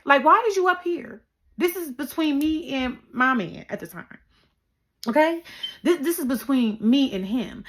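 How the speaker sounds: high, loud sassy telling-off; sassy scolding. Retold or imitated. Teen daughter ordering her mom out, loud and sassy. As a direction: tell her off with attitude.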